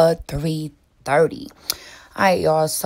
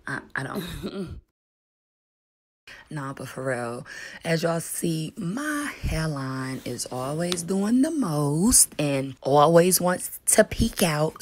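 ordinary talk

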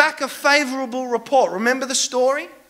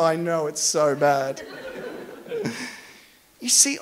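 ordinary delivery

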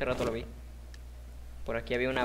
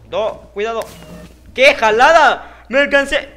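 furious shout; 9 semitones higher, 14 dB louder than usual. Real, not acted. Gamer yelling at his own fail, furious. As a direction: scream it at the game, furious. Gamer raging at failed jump.

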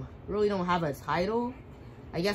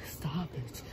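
breathy whispered plea; 4 semitones lower, 7 dB quieter than usual. Acted. Player whispering to the game character, a whispered stop. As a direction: whisper it under your breath. Whispered then repeated aloud.

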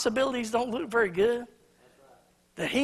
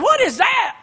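much higher, louder scoffing question; sarcastic; 11 semitones higher, 8 dB louder, slower than usual. Retold or imitated. Cynical disciple scoffing to Jesus, sarcastic and loud. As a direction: scoff at the tiny lunch. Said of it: "I think he does it sarcastically".

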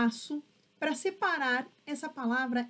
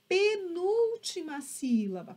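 penúltima sílaba slowly, NÚL drawn out; measured, stressed penúltima sílaba; slow emphatic term; 4 semitones higher, slower than usual. Shown as a demonstration. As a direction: stretch penúltima sílaba for the class. Teacher names the stressed position.

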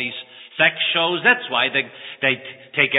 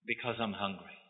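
ashamed quiet confession; 5 semitones lower, 16 dB quieter than usual. Acted. Thief confesses to lawyer.

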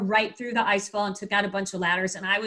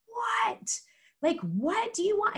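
retold yell; 11 semitones higher, slower than usual. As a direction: act out calling back across the wind. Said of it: "we're yelling".

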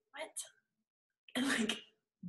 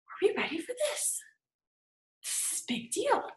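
nervous awe; 12 semitones higher, 4 dB quieter than usual. Retold or imitated. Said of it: "looking at mom going".

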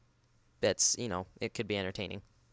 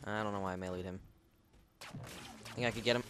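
normal talking voice

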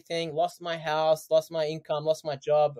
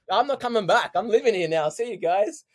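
louder and higher, breezy send-off; carefree excited farewell; 5 semitones higher, 5 dB louder than usual. Retold or imitated. Son imagining waving off family, breezy excited. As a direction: toss it off like you're never coming home.

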